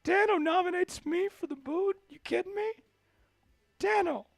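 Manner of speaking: high, hurt mock outrage; mock betrayed disbelief. Real, not acted. Host mock-outraged that Dano nominated him. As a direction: play it as hammed-up disbelief. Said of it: "that makes me wanna cry".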